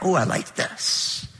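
breathy near-whisper, slow and pleased; slow hushed whisper of approval; hushed admiration. Retold or imitated. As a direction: murmur it softly as if won over. Customer admiring a welcoming place.

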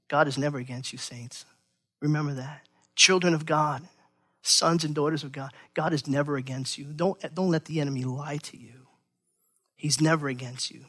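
soft, low and tender; gentle reassurance. Real, not acted. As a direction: reassure them quietly, like a pastor.

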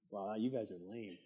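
ordinary talk, nothing marked

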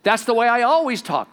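loud, high defensive protest; mock defensive husband. Husband defending his tone to his wife, loud. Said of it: "heard my wife say".

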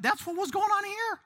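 high-pitched exasperated punchline; animated exclamation. Real, not acted. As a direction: let the voice shoot up high. Exclaims over meddling relatives.

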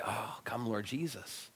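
tired elder whispering a prayer; under his breath. Retold or imitated. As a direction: breathe it out as a weary sigh. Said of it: "sigh quietly under his breath".

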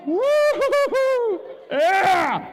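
high, slow, loud whooping cheer; a wild high cheer of pure joy; overjoyed whoop. Retold or imitated. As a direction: whoop like you just won it all. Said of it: "And I was like".

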